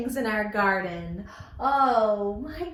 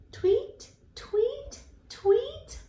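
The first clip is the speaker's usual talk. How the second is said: high, rising little chirps; spaced, high-pitched bird calls; birdie tweets; 10 semitones higher, much slower than usual. Pretend. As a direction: tweet like a bird, each one lifting up. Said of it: "I hear its funny sound".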